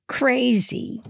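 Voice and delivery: high, scornful one-word correction; sneering. A narrator's reading. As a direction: spell out the obvious with scorn. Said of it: "Louisa sneered".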